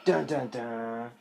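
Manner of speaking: a mock-sinister sting; spooky dun dun dun. Spooky dramatic sting in story.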